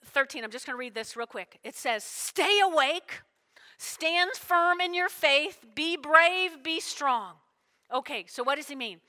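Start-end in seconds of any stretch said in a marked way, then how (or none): 2.0-7.4 loud, high and slow, each command stressed; forceful scripture reading. Acted. Pastor proclaims First Corinthians to the church, loud, slow and high. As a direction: proclaim every line like an order.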